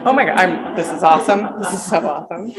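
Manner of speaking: giggling with real joy; delighted laughing. Real, not acted. Author thrilled by the library display, laughing.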